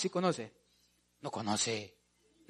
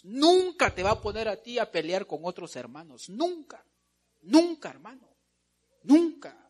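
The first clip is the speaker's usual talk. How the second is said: loud, raised voice, punching each warning; full-force preaching; 5 semitones higher, 7 dB louder than usual. Real, not acted. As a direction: drive it home to the congregation. (predicación enérgica a toda voz)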